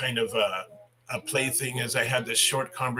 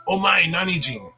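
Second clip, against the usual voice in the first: higher, louder imitation of surprised girls; raised-pitch retold exclamation; young girls imitated; 6 semitones higher, 5 dB louder than usual. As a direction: mimic two girls blurting it out. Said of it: "there was two young girls".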